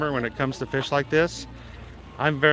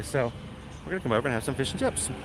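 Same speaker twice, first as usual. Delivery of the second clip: ordinary delivery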